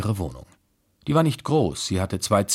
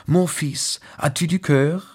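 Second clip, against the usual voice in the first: calling out; 9 semitones higher, 4 dB louder than usual. A narrator's reading. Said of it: "rief sie".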